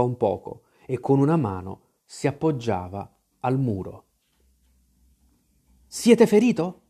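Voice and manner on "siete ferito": high, urgent question; alarmed cry. A narrator's reading. Boy cries out to the staggering captain, high urgent question. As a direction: call it out with raised pitch.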